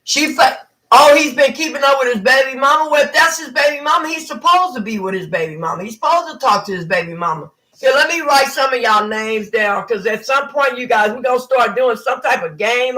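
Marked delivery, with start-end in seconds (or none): none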